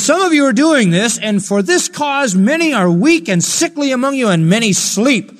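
loud, high declamation of a verse; full-force scripture preaching. Acted. Preacher reading Paul's warning to the Corinthians, full-force preaching. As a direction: proclaim the warning, slowing on the last words.

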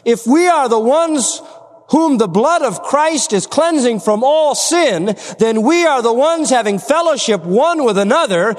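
emphatic pulpit proclamation; full-force preaching. Real, not acted. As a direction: preach it out, leaning on key words.

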